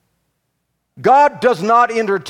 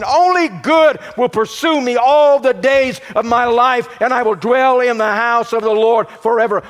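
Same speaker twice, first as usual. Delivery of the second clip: higher, louder preaching build, hammering each line; full-force preaching; 7 semitones higher, 4 dB louder than usual. Acted. Pastor driving the point home to congregation, full-force preaching. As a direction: preach it out, rising to the peak.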